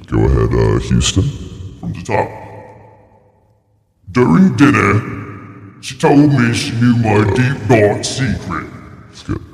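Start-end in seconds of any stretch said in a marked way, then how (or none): 4.1-8.7 high, slow theatrical narration; dramatic story reading. Acted. Reading a horror story from the top, high and drawn out. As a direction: draw it out high like a spooky tale.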